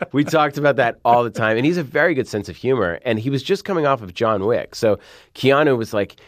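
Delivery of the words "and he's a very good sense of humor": ordinary talk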